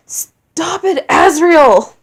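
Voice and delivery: loud anguished shout. Acted. Asgore shouts at his taunting son to stop. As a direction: yell at him to stop. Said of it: "Asgore shouted".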